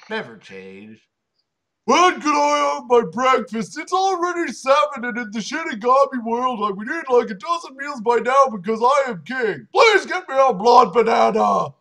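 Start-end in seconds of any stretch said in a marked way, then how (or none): 1.8-11.7 loud, high, bratty royal demand; shouting for his breakfast; demanding king shout. Acted. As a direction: yell it like a spoiled king wanting breakfast. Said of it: "said Blood as he danced".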